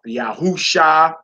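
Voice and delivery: Yahusha slowly, as ya-hoo-SHA; correct pronunciation. Shown as a demonstration. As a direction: draw out Yahusha to an open sha. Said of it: "Brothers should be saying".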